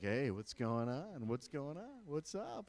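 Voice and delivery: smooth wink voice. Retold or imitated. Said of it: "It's like".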